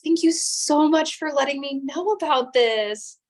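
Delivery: high, gushing accepting voice; overly grateful colleague. Retold or imitated. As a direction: play an eager, welcoming coworker. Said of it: "you're not always going to be greeted with".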